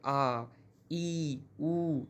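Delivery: आ, ई, उ each held long; long vowels. Shown as a demonstration. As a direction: draw out आ, ई, उ, one by one. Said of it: "थोड़ा ज़्यादा समय लगता है".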